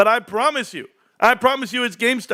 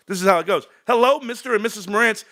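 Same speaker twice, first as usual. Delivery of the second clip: normal talking voice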